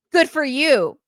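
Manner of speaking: mock congratulations. Acted curt reply to pregnancy news.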